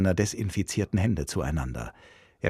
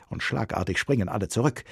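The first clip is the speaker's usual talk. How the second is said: ordinary delivery, nothing marked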